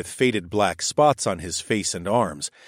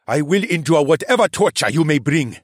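higher, louder gritted vow; grimacing defiance; 7 semitones higher, 6 dB louder than usual. A narrator's reading. The cheetah defies the narrator, strained and loud. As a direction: force the words through a grimace. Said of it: "replied with a grimace".

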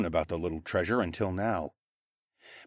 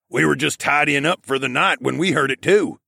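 a lightly roughened male voice, louder; rough gravelly voice; 6 semitones higher, 6 dB louder than usual. A narrator's reading. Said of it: "Mel graveled out in his rough voice".

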